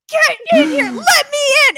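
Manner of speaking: high, loud mock-frantic yelling; freaking-out shout. Man banging on the door, freaking out. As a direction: imitate someone pounding to be let in. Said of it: "he's, like, freaking out".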